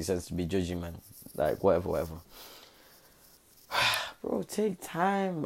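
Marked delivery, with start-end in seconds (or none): 4.2-5.4 drawn-out, high, slowed right down; telling himself to slow down. Real, not acted. A vlogger telling himself to take his time, slowly. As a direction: stretch it out to calm himself. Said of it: "I don't know why I'm talking so fast".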